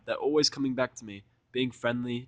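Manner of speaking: plain reading voice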